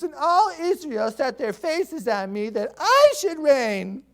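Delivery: high, slow, whiny voice of a spoiled prince; whining prince. Acted. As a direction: whine it like a grown man sulking. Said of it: "he's just whining".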